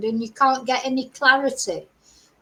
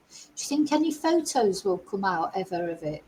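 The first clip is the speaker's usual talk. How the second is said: ordinary talk, nothing marked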